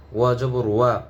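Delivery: ordinary talk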